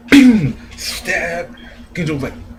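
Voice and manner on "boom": loud, high cartoon boom; mock explosion. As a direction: belt out a comic-book boom. Mimicking the stab attack.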